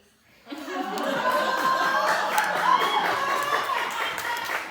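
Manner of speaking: helpless long laugh, loud and high. Real, not acted. Reader overcome by the Muscovy joke, laughing hard.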